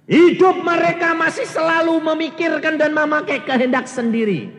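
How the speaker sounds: high, loud sermon climax; preaching. Acted. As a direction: preach with full force. Preaching at full force.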